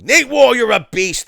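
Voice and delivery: loud, high burst of hype; excited shout. Real, not acted. Streamer hyping up a viewer for his super chat, excited shout. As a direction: yell it out like a fired-up host.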